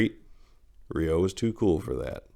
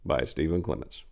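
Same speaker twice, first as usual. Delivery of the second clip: ordinary delivery, nothing marked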